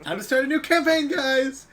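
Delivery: high, loud, hammed-up game-over cheer; mock cheerful announcement. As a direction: announce the wipe with a big fake grin. GM jokes after rock kills everyone.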